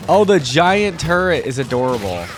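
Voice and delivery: ordinary delivery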